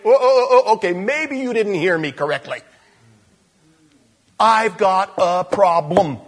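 high, loud mock insistence at God; mock exasperation. Believer repeating his problem to God, mock exasperated. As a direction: play the stubborn believer who won't listen.

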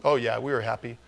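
ordinary talk, nothing marked

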